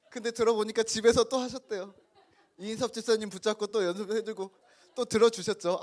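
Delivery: amused, laughing narration; laughing while telling. Real, not acted. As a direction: let the laugh carry the story. Laughing while telling about deacon.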